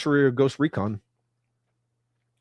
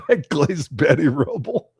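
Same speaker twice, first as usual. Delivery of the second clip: laughing through it; 5 semitones higher, 4 dB louder than usual. Real, not acted. Laughing at own joke.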